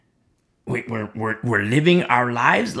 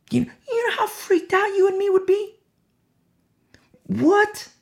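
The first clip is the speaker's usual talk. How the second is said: squeaky high, incredulous gasp of surprise; hearing the news, shocked and incredulous; shocked disbelief. Acted. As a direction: jump way up in pitch, stunned.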